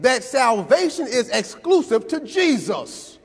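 full-force preaching. Acted. Preacher driving the point home to the congregation, full-force preaching. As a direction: whoop it up like the peak of a sermon.

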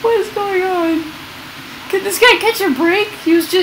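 baffled by the man getting hit by cars; bewildered. Real, not acted. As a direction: disbelief at another bad break. Bewildered at stranger's bad luck.

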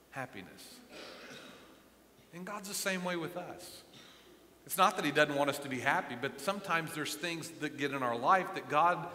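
ordinary talk, nothing marked

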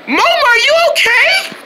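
high, drawn-out holler; slow high-pitched shout; worried call. Acted. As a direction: call out to check on them. Calling to someone after the jump.

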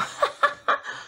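quick airy high giggle; breathy giggle. Real, not acted. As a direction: let out a short breathless laugh. Giggling at her red face.

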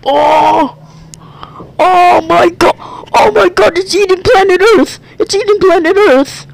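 high, loud panicked shriek. Real, not acted. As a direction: shriek in horror at the screen. Watching planet being eaten, panicking.

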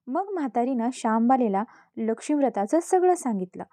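ordinary delivery, nothing marked

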